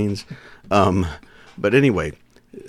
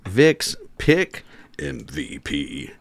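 drawn-out slow title; slow dramatic announcement; much slower than usual. Acted. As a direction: spell it out slowly for effect.

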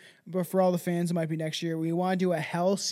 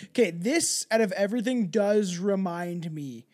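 ordinary talk

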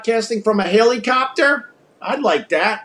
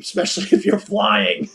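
laughing; 4 dB quieter than usual. Real, not acted. Laughs at own joke.

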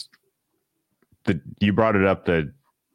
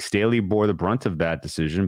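normal talking voice

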